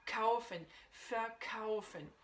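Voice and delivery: slow "verkaufen", weight on "kau"; stress on KAUFEN. Shown as a demonstration. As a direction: say "kaufen", then "verkaufen" with the same accented "kau". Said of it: "l'accentuation est bien sur le kaufen".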